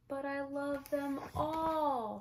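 put-on a small boy begging to keep his toys; pleading little boy. Spencer begging his mom to keep toys, high boyish plea.

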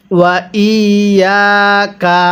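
slow, level ওয়া ইয়াকা; short ওয়া, then a long doubled ya in ইয়াকা; model recitation. Shown as a demonstration. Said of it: "এরপরে পড়ুন".

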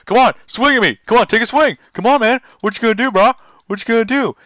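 lightly voiced bro picking a fight; taunting tough guy. Gamer voices the boss as a bro picking a fight, mock taunt. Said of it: "It's like".

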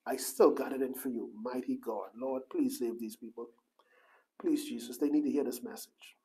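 low, soft pleading prayer under the breath; hushed prayer. Acted. Preacher murmuring a prayer to God, softly. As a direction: pray quietly for the people.

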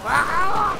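high squeaky mock scream; imitated scream. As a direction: squeal like a game character. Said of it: "I heard your guy just go".

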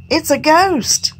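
high, slow terrified cry; frightened cry. A narrator's reading. Disciples in the boat see a figure, terrified cry. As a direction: cry out in fear at the ghost. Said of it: "cried out in fear".

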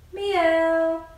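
loud cat meow. Shown as a demonstration. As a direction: belt out a playful cat meow. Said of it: "make the cat noise".

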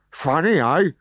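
high, loud snarl. Acted. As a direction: snarl at the recruit. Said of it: "snarled the trainer".

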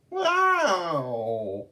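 high, loud wailing shriek; a spooky shriek to scare the kids; ghostly shriek. Acted. Day Day scaring the children with a ghost shriek. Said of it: "gave a horrifying shriek".

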